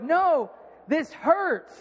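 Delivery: high, very drawn-out mock wail; imitated yell of protest; retold pained protest. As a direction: stretch it out like a hurting kid. Acts out protesting to the doctor.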